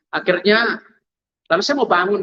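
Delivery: ordinary talk, nothing marked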